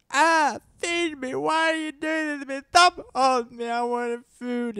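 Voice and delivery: put-on high, slow wailing baby voice; high crying baby, very slow and whiny. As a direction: voice the hungry baby's wail. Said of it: "He's like".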